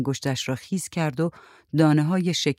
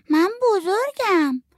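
put-on very high, slow little-kid voice; small child; 3 dB louder, slower than usual. A narrator's reading. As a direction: answer like a proud little child. Child character answers the adult. (صدای نازک و کشیدهٔ بچه)

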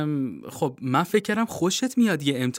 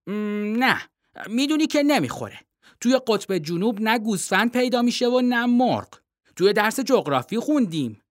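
put-on a boy's voice, higher, insistent; young boy Timothy; 7 semitones higher than usual. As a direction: read the boy higher and earnest.

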